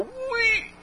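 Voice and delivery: high soft squeal; a quiet little high wheee; high and soft squealed whee. Pretend.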